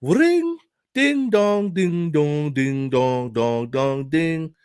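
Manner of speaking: sung ding dong, high at first then falling; doorbell imitation. Explaining the bell to viewers, sung doorbell chime. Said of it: "it's a bell".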